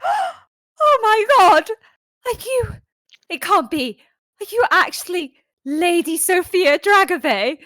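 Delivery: very high, loud, breathless shriek; starstruck gasp. Acted. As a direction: gush like a starstruck superfan. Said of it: "barge over and be like".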